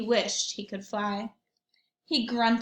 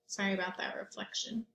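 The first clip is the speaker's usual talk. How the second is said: quick whispered apology; whispered aside; 2 semitones lower, 10 dB quieter, faster than usual. Real, not acted. Aside to viewers.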